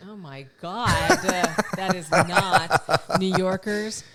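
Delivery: cracking up at the bad accent; laughing. Real, not acted. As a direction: laugh while protesting. Cohost reacts to bad accent.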